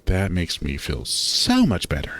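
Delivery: ordinary delivery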